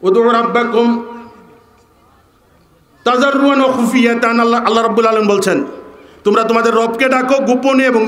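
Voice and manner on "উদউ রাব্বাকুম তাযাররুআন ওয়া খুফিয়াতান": Quran recitation. Acted. Quotes Quranic verse in Arabic.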